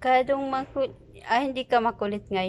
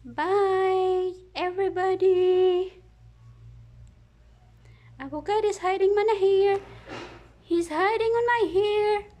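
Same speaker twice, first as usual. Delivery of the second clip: high cheerful voice; 6 semitones higher, slower than usual. Real, not acted. High goodbye to camera.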